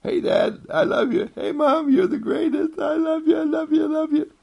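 put-on very high, loud child calling out; high, loud child's cry of love; excited child voice. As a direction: squeal it like an eager little kid. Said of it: "popping up all the time saying".

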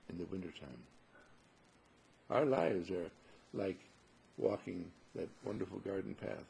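normal talking voice

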